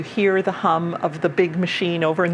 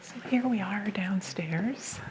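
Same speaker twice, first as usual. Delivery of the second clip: library whisper; 10 dB quieter than usual. Real, not acted. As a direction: whisper quietly while showing around. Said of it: "now we can use our regular voices again".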